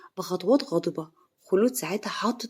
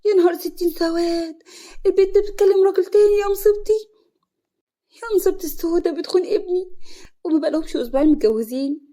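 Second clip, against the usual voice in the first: high, loud lament of a scandalized mother; horrified wailing; 9 semitones higher, 7 dB louder than usual. A narrator's reading. Mother discovering her son's wife betrays him, wailing in horror. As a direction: wail it out in shock, pitched way up. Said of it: "بتمسك صدرها وتقول".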